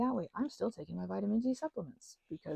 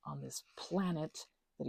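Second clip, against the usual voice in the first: whisper; 6 semitones lower, 7 dB quieter than usual. Real, not acted. Unvoiced whisper opening.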